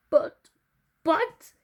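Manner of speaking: high loud shocked stammer; gasped. A narrator's reading. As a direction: sputter in disbelief. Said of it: "she gasped".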